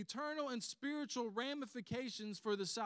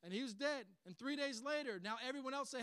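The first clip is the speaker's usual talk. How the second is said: ordinary delivery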